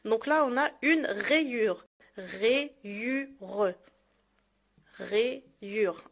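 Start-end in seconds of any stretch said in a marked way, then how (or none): 2.2-3.7 "Rayure" split into three separate beats; syllable by syllable. Shown as a demonstration. Teacher splitting "Rayure" for learners, syllable by syllable. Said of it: "تقطع الكلمات كيتسمى سيلاب". 5.1-6.0 "Rayure" broken up for counting; syllable by syllable. Shown as a demonstration. Teacher counting syllables in "Rayure", syllable by syllable. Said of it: "Un, deux, trois".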